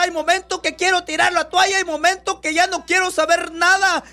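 predicador clamando a Dios con fervor; oración predicada. Acted. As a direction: ruega con fuerza como en oración. Fervent prayer preaching. (ora a voz en cuello ante la congregación)

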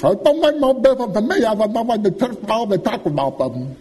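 put-on high, fast old-woman voice; high quick granny voice, unbothered; toothless old aunt. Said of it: "She said".

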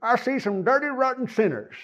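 high, squeaky puppet voice; ventriloquist dummy voice. Retold or imitated. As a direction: chirp it like a cheeky dummy. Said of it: "That's what he would say".

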